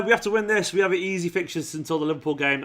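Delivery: ordinary talk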